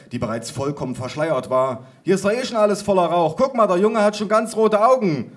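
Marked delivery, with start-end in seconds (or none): 2.0-5.3 high, raised-voice protest; alarmed objection. A narrator's reading. Cousin worried about boy in smoke, high protest. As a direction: object urgently and loudly.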